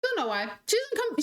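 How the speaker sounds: normal talking voice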